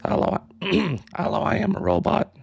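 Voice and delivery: a weak, barely changed robot voice; half-hearted attempt at a machine voice. Shown as a demonstration. Showing chat her robot voice, lightly done. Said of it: "so I can do robot voice".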